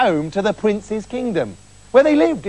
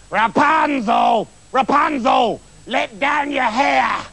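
high, drawn-out witch's shout; witch calling up; 6 semitones higher, slower than usual. A narrator's reading. Witch shouts up to Rapunzel in the tower, high drawn-out call. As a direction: holler up the tower, stretching each word. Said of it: "the witch came up to the tower and called".

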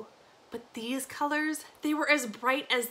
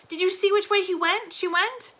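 urgent question pitched high; frantic questioning; 8 semitones higher, 5 dB louder than usual. A narrator's reading. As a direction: press a stranger for an answer, raised voice. Frantic search for missing sister.

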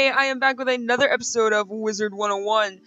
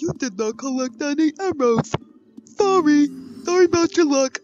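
mocking game; 6 semitones higher, 5 dB louder than usual. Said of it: "and then it's like".

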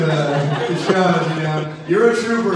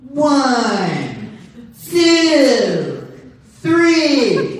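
rising, louder grunted count; gym guy counting reps; 9 semitones higher, 6 dB louder, much slower than usual. Retold or imitated. Guy next to me at the gym, counting reps in a loud rising strain. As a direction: count out each rep like a straining lifter. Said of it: "He's just like".

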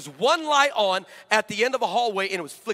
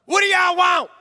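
high, loud yell of a creepy figure; a sudden loud shout from the shadows; monster yell; 9 semitones higher, 8 dB louder than usual. Retold or imitated. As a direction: bellow it out of the dark. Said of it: "it yells at us".

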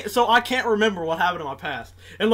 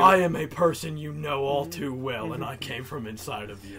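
lightly voiced ominous villain, lower and softer; 7 semitones lower, 5 dB quieter than usual. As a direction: read it like a quiet menacing villain. Reads villain dialogue aloud.

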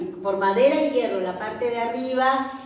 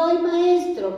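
high, sudden shout of "Ay, maestro"; pitched-up shout for the master; mock cry; 6 semitones higher than usual. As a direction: yell "Ay, maestro" like a frightened disciple. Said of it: "y gritó".

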